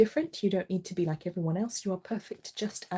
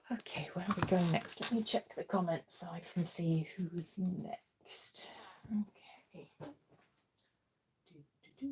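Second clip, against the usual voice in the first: whispering; 7 dB quieter, slower than usual. Real, not acted. Whispering while checking comments.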